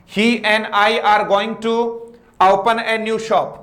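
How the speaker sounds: slow, every word spaced out; slow dictation. Shown as a demonstration. Teacher rereading the sentence, slow and even. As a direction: read it out so students can copy. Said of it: "teacher rereads the sentence slowly".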